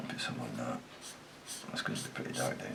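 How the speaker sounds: soft whisper to himself; whispered aside. Real, not acted. Artist whispering to himself over the page. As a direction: breathe it under the breath. Painter muttering to himself while working.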